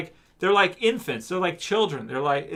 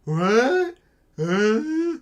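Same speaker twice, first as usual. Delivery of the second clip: clueless zombie voice; 5 semitones higher, much slower than usual. Retold or imitated. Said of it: "they're like".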